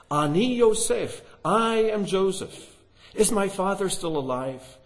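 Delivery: high, loud, slow cry of revelation; loud emotional cry. A narrator's reading. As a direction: call it out as Joseph unmasking himself. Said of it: "He cried in a loud voice".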